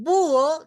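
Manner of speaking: búho fuerte, BÚ-o en dos sílabas; hiato marcado. Shown as a demonstration. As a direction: carga y alarga la ú de búho. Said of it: "digo búho". (búho con la ú tónica, separada de la o)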